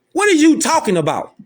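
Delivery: high-pitched, indignant comeback; incredulous challenge. Real, not acted. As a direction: throw the question back at him, pitched high. Rebutting the man in the clip.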